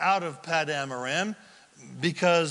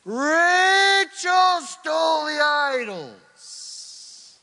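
very slow, high, loud reveal; slow emphatic shout; 11 semitones higher, 6 dB louder, much slower than usual. Acted. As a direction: hammer out each word. Revealing the crucial secret.

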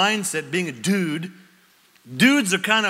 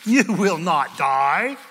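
slow, louder serpent promise; slow serpent lie; 4 dB louder, much slower than usual. Acted. Serpent lying to the woman, slow and insistent. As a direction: draw out the lie slowly. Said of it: "Then the serpent said".

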